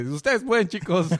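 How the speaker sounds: high, bright cheering voice. Retold or imitated. Deadman cheering heroes on. (porras con voz aguda)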